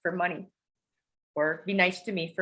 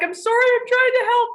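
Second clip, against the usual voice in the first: high, loud, panicked pleading; rushed apology while she screams; frantic apology; 10 dB louder than usual. Retold or imitated. Mom to screaming daughter, frantic high plea.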